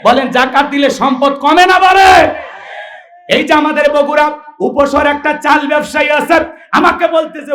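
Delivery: high-pitched, ringing address; full-force preaching. Acted. Preacher presses the crowd about zakat, high and impassioned. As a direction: call out to the crowd for agreement.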